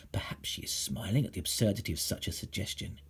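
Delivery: soft, sly whisper. A narrator's reading. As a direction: tell a secret. Whispered aside about teacher.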